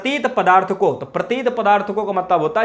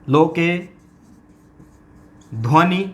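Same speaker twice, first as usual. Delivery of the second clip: dictating word by word; slower than usual. Shown as a demonstration. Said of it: "तो ये दूसरा ये रख लो".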